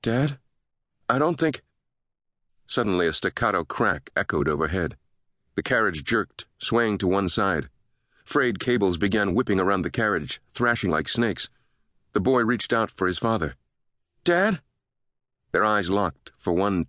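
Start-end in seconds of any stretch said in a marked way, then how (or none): 0.0-1.6 put-on high, uncertain child's voice; a boy starting to worry, voice lifted; higher-pitched worried kid, breaking off; anxious boy. A narrator's reading. Frightened boy in lift. 14.2-14.6 put-on high-pitched alarmed child calling for his father; much higher, urgent question; frightened boy. A narrator's reading.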